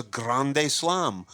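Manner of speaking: "grande slam" in a Spanish accent, GRAHN-day slahm. Shown as a demonstration. Guest shows how Cubans say grand slam, Spanish accent. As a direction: give "grande slam" drawn-out Spanish vowels.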